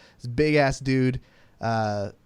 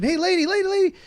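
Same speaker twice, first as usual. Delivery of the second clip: calling out; faster than usual. Retold or imitated. Calling out to distant employee.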